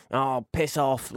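a slow, sulky dismissal, lightly voiced; grumpy mock brush-off. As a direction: drag it out like a moody teen. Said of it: "He's like".

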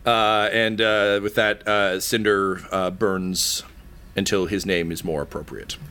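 ordinary talk, nothing marked

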